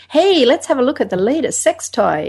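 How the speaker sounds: mock American rah-rah pitch; hammed-up American salesman; a light American accent, cheerful. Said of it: "Why do you need to do that in American accent?".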